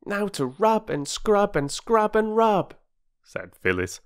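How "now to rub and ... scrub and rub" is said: put-on high, gleeful child chant; joyous girl. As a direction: relish the scrubbing as an excited little girl. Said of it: "hopping joyously about".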